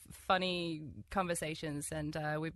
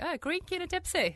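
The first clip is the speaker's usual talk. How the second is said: excited; 10 semitones higher than usual. Retold or imitated. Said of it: "you're like".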